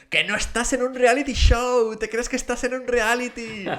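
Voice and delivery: high-pitched mock shout of an outraged friend; retold shouting. Friend scolding the speaker in a heated argument, imitated high shouting. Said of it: "él me gritaba a mí".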